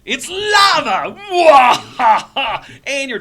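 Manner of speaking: hammy booming villain threat; sneering panto villain. Pretend. DM mock-threatens players.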